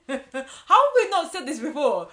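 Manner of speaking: loud laughing burst; giggling at a pun. Real, not acted. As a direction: laugh through the words at your own pun.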